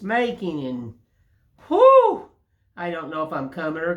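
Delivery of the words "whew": a sudden high, loud exhale of relief; loud whew. Real, not acted. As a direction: blow it out like you're swamped. Worn out by busy day.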